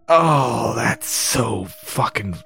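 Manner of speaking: slow, groaning revulsion; drawn-out disgusted groan; real disgust. Real, not acted. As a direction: groan it out slowly, grossed out. Reader disgusted by the picture.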